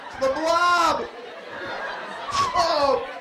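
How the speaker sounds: high mock-horrified cry; shocked yelp. Real, not acted. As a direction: shriek like a monster just walked in. Reacting to loud slurp.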